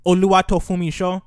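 "Oluwatofumisho" said as a Nigerian name; Nigerian pronunciation. Shown as a demonstration. Speaker giving a friend the long middle name "Oluwatofumisho", Nigerian pronunciation. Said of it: "that's a Nigerian word".

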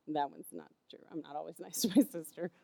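whispered with a laugh; a sheepish giggling confession; laughing aside. Real, not acted. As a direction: laugh it off under your breath. Laughing aside about her sister.

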